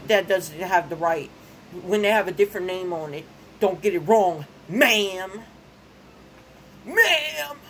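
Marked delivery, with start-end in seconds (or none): 3.6-7.6 rising sharp call of ma'am, last one much higher; calling out to her. Retold or imitated. Ranting at the mail lady, calling ma'am high and loud.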